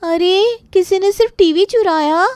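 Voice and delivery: high, loud disbelief; stunned at the theft; shocked. Acted. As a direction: gasp it out in disbelief. Shocked family over stolen TV.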